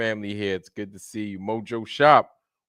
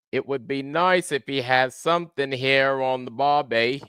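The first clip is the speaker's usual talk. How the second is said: higher-pitched, hammed-up Aussie accent; terrible Australian accent; 5 semitones higher than usual. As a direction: put on a bad Australian accent. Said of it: "that's my terrible Australian accent".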